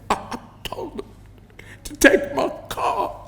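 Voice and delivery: breathy, broken sob; sobbing. A narrator's reading. Shaken woman sobbing in Stefan's arms, breathless sob. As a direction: gasp it out through tears. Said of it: "I sobbed, gasping for breath".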